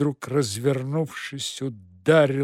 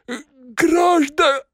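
high hoarse panicked croak; 12 semitones higher, 6 dB louder, slower than usual. Acted. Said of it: "прохрипел".

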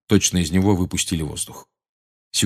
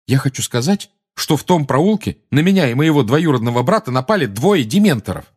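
raised, emphatic testimony; insistent boy witness; 7 semitones higher, 5 dB louder than usual. A narrator's reading. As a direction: press the point louder and higher. Harry insists on his testimony.

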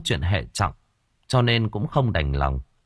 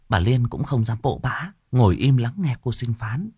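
ordinary delivery, nothing marked

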